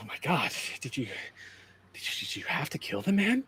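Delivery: lightly voiced, shaken and apologetic; a touch of stunned dismay, halting; shaken bystander. Plays shaken Kelly.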